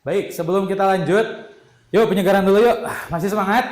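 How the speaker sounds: rally the pupils with clap calls; energizer game leader. Real, not acted.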